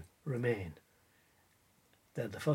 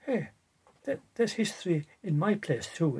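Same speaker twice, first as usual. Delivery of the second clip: ordinary talk, nothing marked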